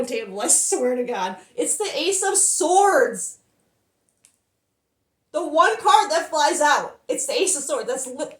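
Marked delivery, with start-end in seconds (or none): 1.5-7.0 higher and louder, slow on the card name; excited disbelief. Real, not acted. Reader shows viewers the card that flew out, excited. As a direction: announce the card in awe. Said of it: "I'm emotional right now".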